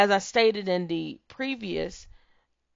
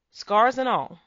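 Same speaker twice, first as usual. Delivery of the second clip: booming motivational speech; 4 semitones higher, 4 dB louder than usual. Real, not acted. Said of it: "motivational speech".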